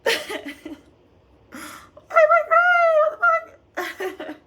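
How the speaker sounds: high-pitched tearful squeal; real, choked-up crying outburst. Real, not acted. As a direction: voice shoots way up as tears take over, loud and drawn out. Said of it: "Why am I crying?".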